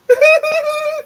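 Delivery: sudden high laughing burst; burst of laughter. Real, not acted. Laughing high and loud at his own prank.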